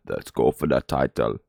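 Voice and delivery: low, slow, lightly put-on fighter voice; a hint of a gruff fighter talking title. Voicing the fighter's title goal, lightly put-on low voice. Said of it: "I'm not gonna do a, a New Zealand accent".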